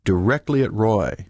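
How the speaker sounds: ordinary delivery, nothing marked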